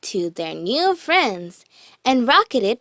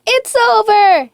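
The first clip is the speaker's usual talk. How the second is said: panicked cry, higher and louder; shouted; 11 semitones higher, 6 dB louder than usual. A narrator's reading. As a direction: Fear yells that all is lost. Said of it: "shouted Fear".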